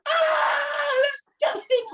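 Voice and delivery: very high star-struck cry; loud awful squeal. Retold or imitated. Woman spotting a film star at the Ritz, squealing. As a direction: re-enact an embarrassing fan shriek, pitched right up. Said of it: "Really loud and really awful".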